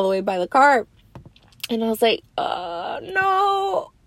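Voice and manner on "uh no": whimpering no. Retold or imitated. Refusing while in pain.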